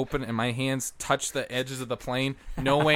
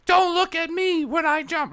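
much higher, slow woman's voice; 5 dB louder than usual. Retold or imitated. Retelling a skydiver's warning in a high female voice. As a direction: lift it high and slow like her. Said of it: "she was like".

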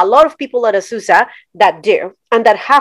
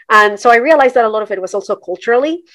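ordinary talk, nothing marked

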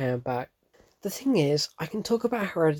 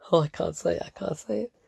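real dread, pitch climbing as the volume drops away; too scared to say it, voice rising and shrinking; genuinely terrified; 4 semitones higher than usual. Real, not acted. Reviewer dreading the film's line, terrified. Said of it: "how genuinely terrified I am right now".

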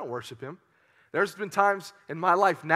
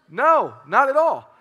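higher, louder, slow emphatic no; emphatic loud answer; 6 semitones higher, 9 dB louder, slower than usual. Acted. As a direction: drive home the answer. Answering own question loudly.

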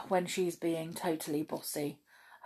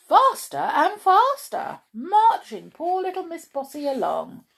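excited; 11 semitones higher, 8 dB louder than usual. A narrator's reading. Excited speeding march.

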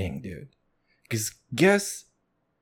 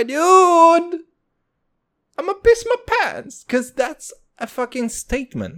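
excited squeal; 6 dB louder than usual. Real, not acted. Hyped squeal over McGregor quote.

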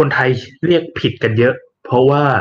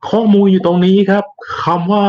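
normal talking voice

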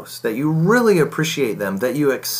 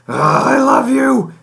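much higher, louder, slow, with an irritated edge; exasperated I love you; 13 semitones higher, 6 dB louder, slower than usual. Shown as a demonstration. To a spouse you're mad at, loud, high, exasperated I love you. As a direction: say I love you while clearly annoyed. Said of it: "but that's really not, "I love you" is not what's being communicated".

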